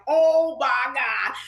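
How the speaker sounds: slow, drawn-out mock horror; horrified dismay. Hairdresser has just drenched Prince, drawn-out dismay. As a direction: stretch it out in dismay.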